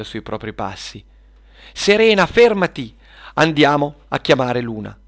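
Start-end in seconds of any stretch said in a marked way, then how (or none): 1.7-5.0 high, loud cry to stop; urgent call. Acted. Camilla stops Serena at the door, calling urgently. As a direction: call out sharply high, then ease down.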